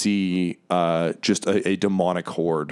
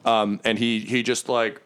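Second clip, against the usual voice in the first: ordinary talk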